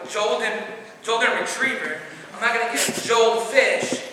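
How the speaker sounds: Jolden and Joel with J where gold has G; mocking J for G. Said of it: "It's not gif".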